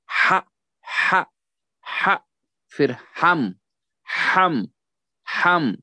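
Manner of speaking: "हा" thrice, then "हम" thrice, each isolated; repetition drill. Shown as a demonstration. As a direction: drill हा and हम with a pause after each. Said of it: "खूब मशक अच्छी तरह से". (हा और हम की रुक-रुक कर मश्क)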